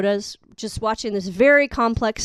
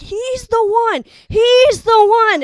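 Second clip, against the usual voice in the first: movie announcement; 10 semitones higher, 8 dB louder than usual. Retold or imitated. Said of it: "They're like".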